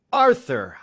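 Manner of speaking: sharp loud cry of a name; father catches son in the act, screams his name. Acted. Father catching his son at night, screaming his name. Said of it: "I screamed".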